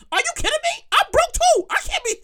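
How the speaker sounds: indignant broke friend shrieking in shock; outraged squeal. Pretend. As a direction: voice shoots way up in disbelief. Outraged at request for money.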